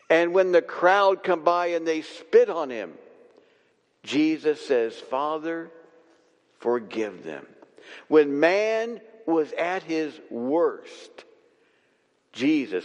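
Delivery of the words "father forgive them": softer, lower reverent prayer; hushed prayer. Acted. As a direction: breathe it out like a dying man's plea. Voicing Jesus' prayer on cross.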